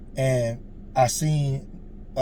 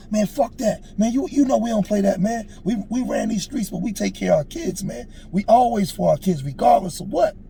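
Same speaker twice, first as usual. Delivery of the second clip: high, loud, worked-up retort; retold shouting match with a close friend; heated argument; 9 semitones higher, 3 dB louder than usual. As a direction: argue him down like it's getting heated. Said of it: "it got loud, like we was going at it".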